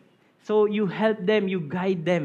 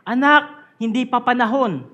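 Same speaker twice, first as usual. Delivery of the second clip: put-on louder, higher parent warning her child; raised parental call, said with loving firmness; 4 semitones higher, 8 dB louder than usual. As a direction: call out firmly like a caring mother. Parent reminding child, quoted.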